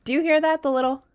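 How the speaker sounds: exaggerated. Shown as a demonstration. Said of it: "be more dramatic with it".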